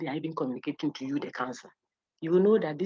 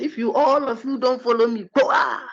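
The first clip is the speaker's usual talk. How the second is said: mock threat; 6 semitones higher, 7 dB louder than usual. Mock threat to force followers.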